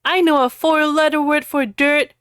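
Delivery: loud, high mock spell shout; hammy scream. Harry cursing Draco, hammy loud. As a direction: fling the curse like a melodrama hero. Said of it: "screamed Harry".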